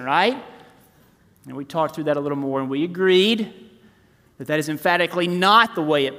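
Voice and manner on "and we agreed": ordinary delivery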